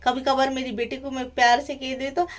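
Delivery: usual speaking voice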